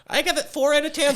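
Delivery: very high put-on partner voice; mock reviewer's partner. Co-host playing the reviewer's love giving a score, high mock voice. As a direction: squeak out the verdict as the partner. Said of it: "What do you give it, love?".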